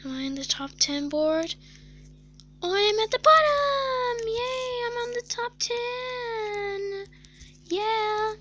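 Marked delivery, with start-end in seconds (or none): 2.6-8.3 high, squealing delight; excited. Real, not acted. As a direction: squeal with glee. Thrilled to be on leaderboard.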